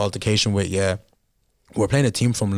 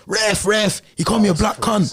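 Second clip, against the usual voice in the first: a light London accent, pitched higher, mocking the opponent; London accent impression; 9 semitones higher than usual. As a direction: imitate the London lad's accent calling the ref, a little higher. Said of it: "in his accent".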